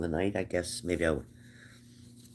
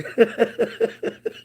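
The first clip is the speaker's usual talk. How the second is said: real burst of laughter; breathy helpless laugh; 7 dB louder than usual. Real, not acted. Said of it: "I started laughing".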